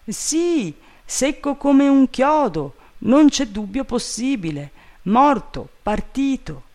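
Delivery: high-pitched servant voice; high, emphatic character reply; emphatic servant Jupiter. A narrator's reading. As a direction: insist in a high, acted voice.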